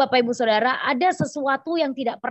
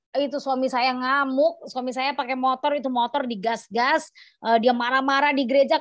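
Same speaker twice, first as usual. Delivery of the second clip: ordinary talk, nothing marked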